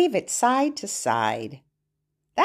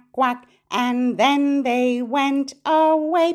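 put-on reading to a toddler; 3 semitones higher than usual. Chants the turkey and duck rhyme.

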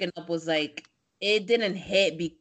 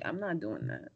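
flat low refusal; 10 semitones lower, 13 dB quieter than usual. Retold or imitated. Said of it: "I'm like".

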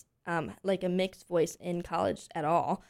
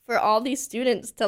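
ordinary delivery

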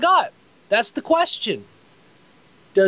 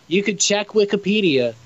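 ordinary talk, nothing marked

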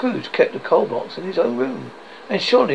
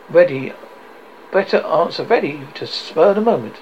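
ordinary delivery

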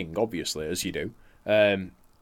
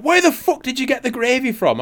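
boss's outrage; 13 semitones higher, 4 dB louder than usual. Retold or imitated. Boss voiced, outraged.

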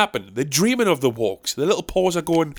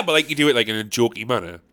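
ordinary talk, nothing marked

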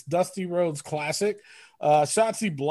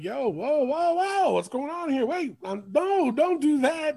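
surprised protest; 8 semitones higher than usual. Retold or imitated. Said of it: "I'm like".

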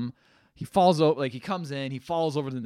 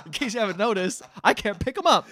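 high, loud, sarcastic objection; exasperated protest; 8 semitones higher, 6 dB louder than usual. Retold or imitated. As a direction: act out an indignant refusal. Acted exasperated protest to boss.

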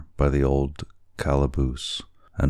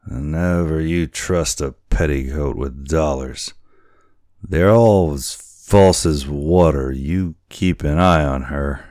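put-on old sailor; 6 dB louder than usual. A narrator's reading. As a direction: sneer like a gruff old salt. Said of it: "said the boatswain".

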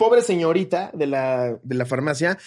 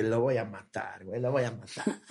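seething under the breath, retold; low muttered threat; 7 semitones lower, 11 dB quieter than usual. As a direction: mutter it privately behind someone's back. Muttered threat from back of queue.

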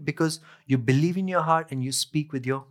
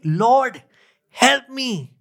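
higher, slightly louder cry for help; mild plea; 6 semitones higher, 4 dB louder, much slower than usual. A narrator's reading. Kneeling woman begs Jesus for help, pleading. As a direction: plead in a raised preacher's voice.

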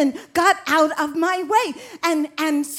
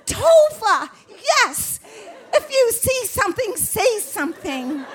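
much higher, laughing through the words; laughing delight; 9 semitones higher than usual. Real, not acted. Answering Tova's only English words, laughing delight.

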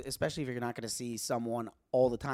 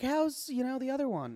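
lightly voiced mom, pitched up; higher-pitched, retold motherly question; imitating mom; 9 semitones higher than usual. Mom asking son after his girlfriend, lightly imitated in higher pitch.

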